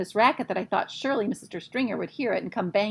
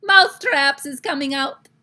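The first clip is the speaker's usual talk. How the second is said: louder triumphant shout; 8 semitones higher, 13 dB louder than usual. Acted. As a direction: proclaim it at full voice. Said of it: "She raised her voice louder than ever and shouted".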